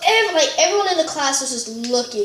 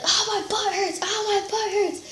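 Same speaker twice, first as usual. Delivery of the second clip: mock pained screaming; 8 semitones higher, 4 dB quieter than usual. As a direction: whine it in mock agony, high-pitched. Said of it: "he was just screaming in pain".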